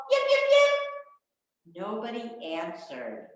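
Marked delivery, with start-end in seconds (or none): 0.1-1.8 high, loud, slow little fox yips. Acted. Little fox calls toward the music, yipping high. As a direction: call out like a small fox, much higher. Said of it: "She called".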